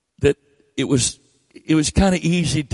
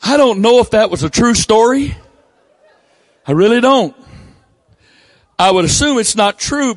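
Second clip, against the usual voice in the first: higher and louder, playful protest; louder teasing; 6 semitones higher, 5 dB louder than usual. Real, not acted. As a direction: raise your voice over the laughter. After the congregation laughs.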